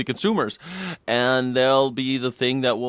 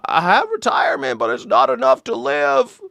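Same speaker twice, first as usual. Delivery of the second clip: high, strained old-timer impression; put-on old person, stammering and pitched up; struggling old worker; 11 semitones higher than usual. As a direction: plead like a hammed-up elderly worker. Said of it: "The one that are like".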